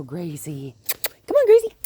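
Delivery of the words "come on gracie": very high, much louder call. Real, not acted. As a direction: call her across the field. Calling dog.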